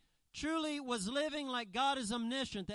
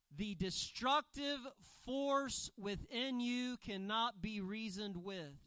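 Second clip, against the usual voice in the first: normal talking voice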